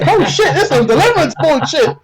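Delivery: high, loud, laughing alarm; half laughing, half spooked; freaked-out laughing exclamation; alarmed disbelief. Retold or imitated. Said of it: "I'm like".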